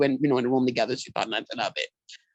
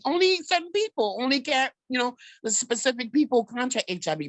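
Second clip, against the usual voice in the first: high-pitched retold protest; confused, upset; 8 semitones higher than usual. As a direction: replay your bewildered younger self, pitched up. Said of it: "hurt, confused".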